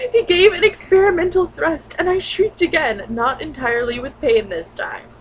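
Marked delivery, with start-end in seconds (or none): none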